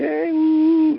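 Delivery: high excited whoop; cheering yell. Retold or imitated. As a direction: whoop it out like a crowd.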